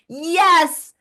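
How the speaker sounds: high, loud cheerleading burst; excited callout. Real, not acted. Host rallying everyone listening to make change, excited callout. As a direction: whoop it out to the whole audience.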